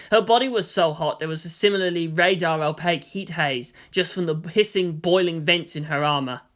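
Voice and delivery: plain reading voice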